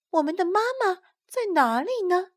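put-on high, childlike questioning voice; high little creature voice; little tadpoles. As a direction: voice a baby tadpole wondering. Tadpoles ask each other.

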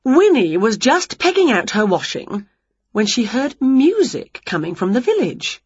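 ordinary delivery, nothing marked

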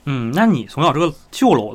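normal talking voice

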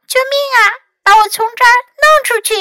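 very high, loud little cry; tiny voice. A narrator's reading. Louis stuck in a box, tiny voice calling. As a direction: squeal it out, quick at the end. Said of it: "用小小的声音叫道".

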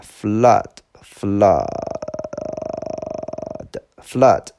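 "flood" with a short open "uh", once hugely drawn out; teacher's demo of "flood", vowel held long; small-mouth vowel. Shown as a demonstration. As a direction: stretch the vowel of "flood" to show it, then say it normally. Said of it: "注意这个单词是小口啊".

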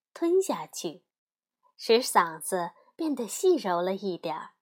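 plain reading voice

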